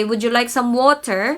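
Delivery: water with its final R sounded; "water" ending in a full "ter"; R pronounced. Shown as a demonstration. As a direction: say "water" with a clear R at the end. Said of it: "Sometimes when I say".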